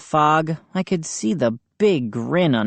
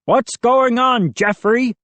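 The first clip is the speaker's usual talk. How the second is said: higher, louder mild shout; 8 semitones higher, 4 dB louder than usual. A narrator's reading. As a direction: yell out the window. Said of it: "I heard Mister McCall shout".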